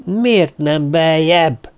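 slow, drawn-out screech, mildly acted. A narrator's reading. Törpapa asking the others about the burial, screeching. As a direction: screech each word out in exasperation. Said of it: "rikácsolta Törpapa".

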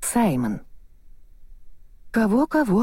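plain reading voice

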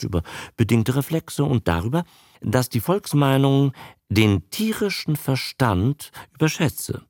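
usual speaking voice

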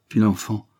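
ordinary delivery, nothing marked

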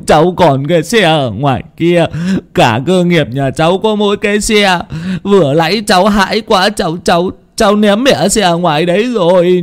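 high-pitched wailing plea for help; tearful, stuttering begging; panicked stammering. A narrator's reading. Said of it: "mà lắp bắp".

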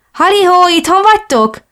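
high, loud call into the flat. Acted. Ellen arriving unannounced calls out to the household, high and loud. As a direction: sing out a cheery hello from the doorway.

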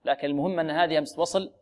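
normal talking voice

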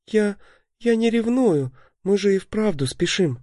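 high, stammering shy protest; flustered denial. A narrator's reading. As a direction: deny it shyly, flustered. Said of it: "возразила Лита, опустив головку".

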